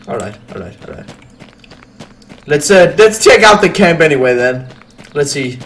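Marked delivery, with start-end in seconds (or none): none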